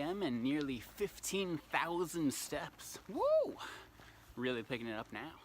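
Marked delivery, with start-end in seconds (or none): 3.1-3.6 sudden high whoop of joy; excited whoop. Real, not acted. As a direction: let out a quick happy cheer. Cheering steps milestone alone.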